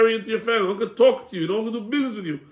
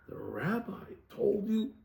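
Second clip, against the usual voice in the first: hushed suspicious priests; 3 semitones higher, 12 dB quieter, slower than usual. Acted. Priests catching the Roman out, slow and suspicious. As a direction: draw it out as the trap springs.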